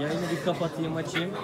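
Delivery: ordinary talk, nothing marked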